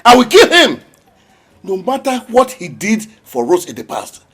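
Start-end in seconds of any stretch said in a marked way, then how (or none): 0.1-0.8 louder furious threat; angry threat. Acted. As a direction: spit out the threat in rage. Enraged father threatens.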